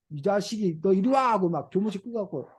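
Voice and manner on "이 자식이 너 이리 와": ordinary talk, nothing marked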